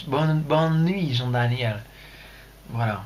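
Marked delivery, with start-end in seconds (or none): 0.1-1.8 put-on drawn-out English-accented French greeting; slow mock English accent. Streamer wishing Jean-Daniel good night, English accent. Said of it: "ça claque bien avec l'accent anglais".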